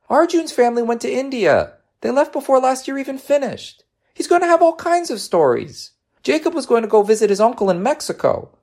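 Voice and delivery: put-on very high-pitched child complaint; upset young boy. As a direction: list grievances in a little boy's voice.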